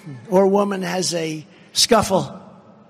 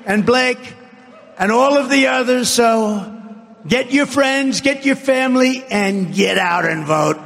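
raised pitch rallying call; rally speech; 6 semitones higher than usual. Real, not acted. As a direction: urge the crowd to get out.

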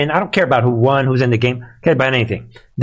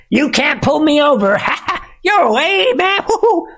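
much higher, laughing mock jeer; mocking taunt; 13 semitones higher than usual. Commentator mimics criminals gloating at police, high laughing taunt. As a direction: gloat at the cops with a giggle.

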